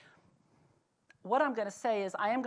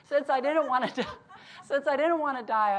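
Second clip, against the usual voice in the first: quoting "Since I didn't wanna die" through real laughter, higher and louder; laughing; 6 semitones higher, 9 dB louder than usual. Real, not acted. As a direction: repeats the husband's line while cracking up. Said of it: "I can hardly say".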